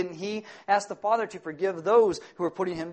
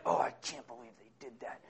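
low, soft grumble under the breath; muttered inner grumble; 7 semitones lower, 10 dB quieter than usual. Retold or imitated. Driver grumbling to himself after being cut off, low mutter. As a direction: mutter it to yourself in the car.

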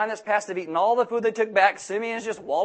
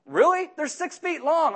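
high, disbelieving "Really? They're six feet long"; incredulous; 8 semitones higher, slower than usual. Retold or imitated. A Lowe's clerk suspects him of theft, so he answers high and incredulous. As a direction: scoff that anyone could pocket six feet of shelving. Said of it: "I'm like".

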